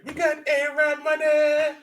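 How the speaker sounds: high, slow put-on bragging voice; hammed-up high boast; mock boast. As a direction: drawl it out like a show-off. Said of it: "Go in there like".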